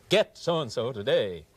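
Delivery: slow, drawn-out advert pitch; TV selling voice. Acted. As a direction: sell it like a television commercial. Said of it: "all those T V selling voices".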